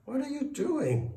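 high, loud, alarmed cry; startled, high-pitched protest. A narrator's reading. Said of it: "cried the farmer".

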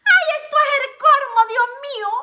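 flustered excitement. Real, not acted. Reacting to a subscriber's crush, flustered and high. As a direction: gush in embarrassed delight.

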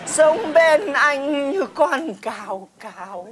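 slow, drawn-out melodrama; mock-nostalgic lament. Woman reminiscing to friend's husband over grasshoppers, wistful lament. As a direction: linger sentimentally on each memory.

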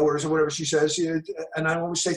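ordinary talk

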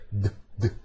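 "D, D" as two short, low d-d bursts; D sound alone. Shown as a demonstration. Teacher isolating the D for students, short low sounds. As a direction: tap out "D, D" as bare hard d sounds. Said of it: "Olha a diferença do D".

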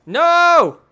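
long loud high "No!"; shout. Real, not acted. Gamer losing his kill, long loud shout. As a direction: wail it in dismay.

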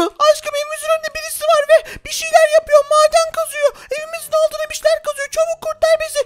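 very high squeaky voice, mildly scared; a tiny spider girl telling her love someone is digging; frightened spider girl. Acted. Spider girl reports danger. (çok ince sesli, hafif korkmuş örümcek kız)

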